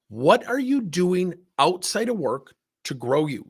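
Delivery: insistent, drawn-out retold question; pointed repeated question. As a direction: spell out "What are you doing outside of work" word by word. Repeats question he failed to answer.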